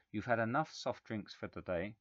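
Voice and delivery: ordinary delivery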